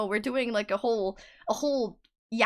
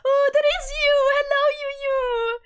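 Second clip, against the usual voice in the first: excited greeting; 12 semitones higher, 5 dB louder than usual. Real, not acted. Greets chatter, still laughing.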